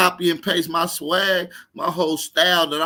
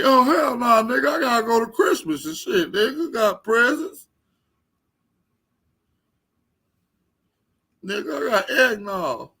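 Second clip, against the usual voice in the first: high, loud, mock-tearful whine; mock a man protesting on the verge of crying; hammed-up crybaby protest; whiny rival impression; 9 semitones higher, 4 dB louder than usual. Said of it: "Sound like he about to cry".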